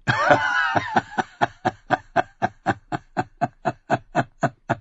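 a big, high, mocking burst of laughter; loud laugh. A narrator's reading. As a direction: laugh out loud in his face. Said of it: "James lachte".

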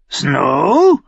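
high, loud croaking bird; raven voice. A narrator's reading. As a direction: squawk the name like a raven. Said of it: "sagte er wieder".

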